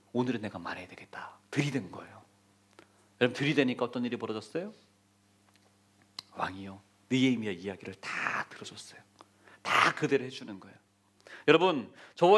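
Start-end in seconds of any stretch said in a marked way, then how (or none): 0.1-2.2 low whisper of a life-or-death decision; whispered resolve. Acted. Nehemiah's risky resolve before king. 6.3-10.8 low whisper, letting it sink in; hushed whisper. Acted. Hushed story payoff.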